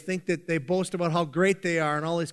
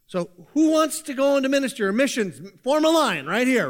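higher, louder tongue-in-cheek invitation; mock recruiting call; 7 semitones higher, 6 dB louder than usual. Preacher jokingly recruits the congregation for missions, loud mock invitation. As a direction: call out for volunteers in jest.

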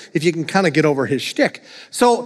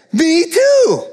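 higher, louder delighted; gleeful me too; excited me too; 10 semitones higher, 3 dB louder than usual. Acted. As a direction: brighten with glee at the match. Joke, excited agreement.

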